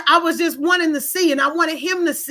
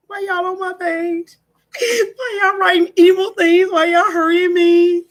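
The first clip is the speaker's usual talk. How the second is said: high, slow mock sobbing voice, louder as it goes; fake crying; 3 semitones higher, 4 dB louder than usual. Asking viewers if they want tears, mock sobbing. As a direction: wail it like a hammed-up fake cry. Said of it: "Y'all want me to cry?".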